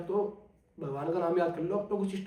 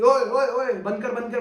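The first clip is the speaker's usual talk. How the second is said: louder mock yell of a possessed friend; imitated screaming; 3 semitones higher, 7 dB louder, slower than usual. Possessed friend screaming during the prayer, retold as a loud mock yell. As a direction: shout it like he's being tormented. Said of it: "चीखें मारनी शुरू कर दी".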